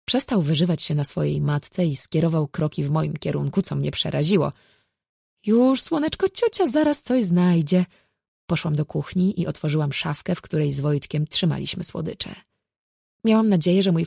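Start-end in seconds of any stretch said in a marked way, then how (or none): 5.5-7.9 put-on high, cooing voice to a toddler; placating auntie. A narrator's reading. Auntie promising the toddler a treat, placating. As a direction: coo brightly at a small child, pitched up.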